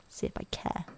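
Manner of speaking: sulky whisper. Acted. Kid muttering after his friend, whispered sulk. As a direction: breathe it out sulkily under your breath.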